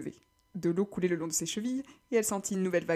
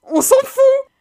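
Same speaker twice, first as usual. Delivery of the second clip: crié; 15 dB louder, much slower than usual. Acted. Liam coupe Kelly.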